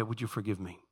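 low, soft plea for forgiveness; humble apology. Shown as a demonstration. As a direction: demonstrate asking forgiveness, very softly. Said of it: "that's how I say".

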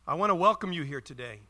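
bright, loud casual greeting; cheery welcome. Retold or imitated. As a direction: boom out a breezy hello. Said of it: "I could hear my opening words".

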